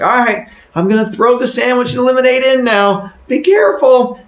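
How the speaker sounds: high, loud put-on voice; called warning. Woman about to toss food in box, high loud warning. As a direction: announce it loudly to someone shut inside.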